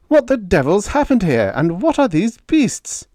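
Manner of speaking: loud, high indignant officer. Acted. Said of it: "Roan said indignantly".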